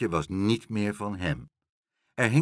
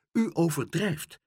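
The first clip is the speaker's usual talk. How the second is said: high, heated retort; hot-tempered; 7 semitones higher than usual. A narrator's reading. Meneer Pen contradicting the porter, hot-tempered. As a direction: cut him off crossly. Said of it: "riep meneer Pen driftig".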